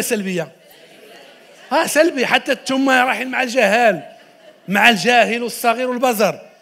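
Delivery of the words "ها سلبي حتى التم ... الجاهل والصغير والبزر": loud words broken by real laughter; amused, laughing out the list of names; laughing loudly. Real, not acted. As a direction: laugh through it at the audience's answer. Audience answer makes him laugh.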